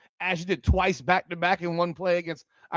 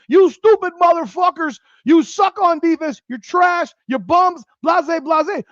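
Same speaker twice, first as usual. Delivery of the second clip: higher, louder furious yell at the team; mock coach yelling; 9 semitones higher, 5 dB louder than usual. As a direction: imitate a coach chewing out her players.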